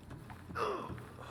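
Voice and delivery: sudden whispered gasp of alarm; startled breathless gasp; sharp gasp. Real, not acted. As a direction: gasp in fright. Startled by crabs in game.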